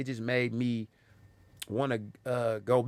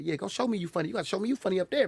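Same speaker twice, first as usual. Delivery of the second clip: higher-pitched challenge to a newcomer; pitched-up impression, pressing the point; Jig; 8 semitones higher, faster than usual. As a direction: imitate a veteran comic daring you. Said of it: "You know how Jig talk".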